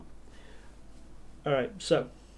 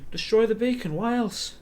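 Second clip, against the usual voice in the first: higher, louder shivering prisoner's outburst; shivering captive; 8 semitones higher, 4 dB louder than usual. Acted. Shivering captive answers why the temple was attacked, defensive. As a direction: blurt it out through the shivers, pitched up. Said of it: "Sort of shivering, he's like".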